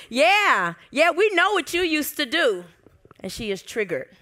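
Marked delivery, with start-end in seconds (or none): 0.1-2.6 high, loud taunting jeer; mocking demons. Demons taunting Mary about her past, loud sneering. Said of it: "the demons are like".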